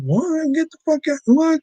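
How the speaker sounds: high, disbelieving cry of shock; shocked disbelief. Retold or imitated. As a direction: yelp it out in stunned disbelief. Stunned reaction to a celebrity death.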